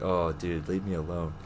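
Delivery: low, muttered grumble under the breath; muttered annoyance. Retold or imitated. Annoyed gym-goer muttering to a chatty stranger, low grumble. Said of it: "It was like".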